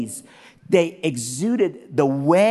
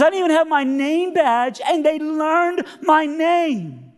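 high wail, very drawn out; emotional cleaning lady; 13 semitones higher, 4 dB louder, slower than usual. Retold or imitated. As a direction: stretch it into a weepy mock wail. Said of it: "I'm just sorry to be emotional".

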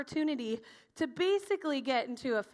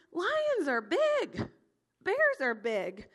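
very slow, stretched-out amazement; kid-like awe; 5 semitones higher, 3 dB louder, much slower than usual. Acted. Teacher thinking as a kid about wild beasts, awed. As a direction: stretch each line in wide-eyed wonder.

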